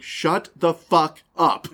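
slow, loud snapped curse at the cat; a teen at the end of his rope, loud and slow; losing it. Retold or imitated. Exhausted teen yelling at a meowing cat, slow and loud. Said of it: "And I lose it".

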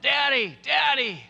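imitating a frightened little girl, high and loud; acted high-pitched cry of fright; scared child's cry. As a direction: call out for Daddy like a scared child. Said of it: "she cried out".

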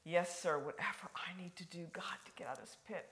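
hushed submission, fading to a whisper; consenting. Acted. David agreeing to do whatever God asks, hushed consent. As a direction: yield humbly, almost under the breath. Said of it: "the word consent, meaning he said".

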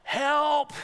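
loud, high cry for help; desperate yell. Retold or imitated. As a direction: call out like your life depends on it. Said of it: "from the depths of my stomach, I yell".

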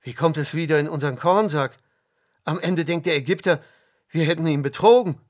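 fast, loud and worried; alarmed. A narrator's reading. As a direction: blurt it out in rising panic. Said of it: "riefen sie erschrocken".

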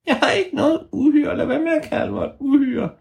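put-on very high little girl elf voice; high squeaky elf voice; tiny elf voice. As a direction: speak as a tiny fairy girl.

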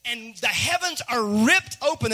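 normal talking voice

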